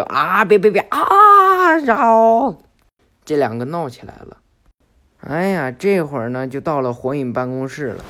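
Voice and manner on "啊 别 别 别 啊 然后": high, loud, drawn-out squeal; yelping protest. Acted. Boruto yelping as Konohamaru holds him, high loud squeal. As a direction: cry out while wriggling free.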